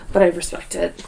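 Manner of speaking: ordinary talk, nothing marked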